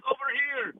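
high-pitched retold holler; imitated call. Man behind comic answering shouter, imitated call. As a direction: mimic hailing a friend from across the room. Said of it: "this dude from India behind me goes".

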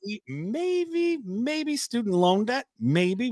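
drawn-out high teasing hope; mock hopeful. Doubtful teasing about loan debt.

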